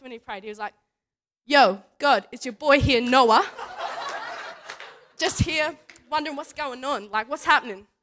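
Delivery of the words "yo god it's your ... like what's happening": put-on loud, casual shout-out in a kid's manner; brash kid praying. Nine-year-old saying his first prayer to God, imitated loud and chummy. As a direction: imitate a cocky kid hailing a mate.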